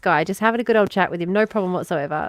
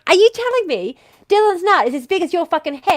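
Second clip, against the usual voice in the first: raised, higher-pitched incredulous question; loudly acted disbelief, pitched up; top-of-the-lungs retelling; 8 semitones higher, 4 dB louder than usual. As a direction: demand an answer from down the road.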